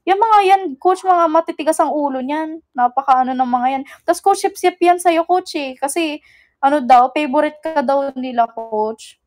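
ordinary talk

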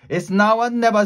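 high, loud and determined; rally for one last big effort; urgent rallying. A narrator's reading. Jack before the final pull, urgently rallying.

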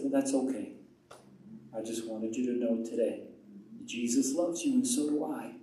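low, soft retold reply; mild, tender and unoffended; gentle reassurance. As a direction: answer rejection kindly and quietly. Retold gentle answer to rejection.

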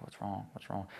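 a quiet, frightened murmur; panicked muttering. Retold or imitated. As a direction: mutter it under your breath, alarmed. Panicking alone in the gym.

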